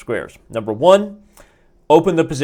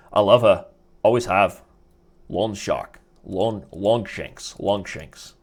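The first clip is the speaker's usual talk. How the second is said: put-on Mel Gibson Scottish accent; 4 semitones lower, slower than usual. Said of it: "I used to be able to do a Mel Gibson accent".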